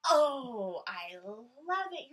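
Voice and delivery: loud, slow gushing delight; gushing praise. Real, not acted. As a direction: overflow with praise. Praising children's smiles.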